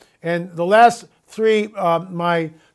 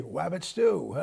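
much softer cartoon hunter voice, a voice unlike his own; Elmer Fudd voice; 2 semitones lower, 11 dB quieter, slower than usual. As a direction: drop to a soft cartoon-hunter voice. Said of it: "Or as Elmer Fudd would say".

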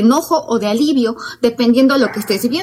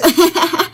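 a short forced laugh, pitched up; fake laugh; 6 semitones higher than usual. Shown as a demonstration. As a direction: laugh just because everyone else does. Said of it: "obviamente no es espontáneo". (risa fingida y corta)

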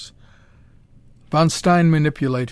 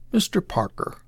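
a hushed, weighty address, pitched higher; impressively; 5 semitones higher, 3 dB quieter than usual. Acted. The doctor impresses his power on Parker, weighty. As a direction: lean on his name to impress. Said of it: "said the doctor impressively".

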